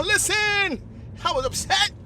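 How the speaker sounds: usual speaking voice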